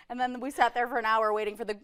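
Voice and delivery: normal talking voice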